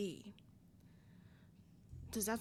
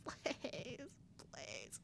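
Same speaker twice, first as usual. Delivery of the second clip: pleading; 4 semitones higher, 8 dB quieter, much slower than usual. Real, not acted. Begging game not to quick time.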